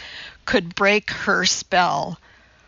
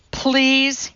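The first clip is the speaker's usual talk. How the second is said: higher-pitched plea; begging; 6 semitones higher than usual. A narrator's reading. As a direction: beg with a single stretched word. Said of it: "he begged".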